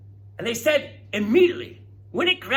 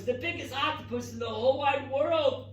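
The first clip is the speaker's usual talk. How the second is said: normal talking voice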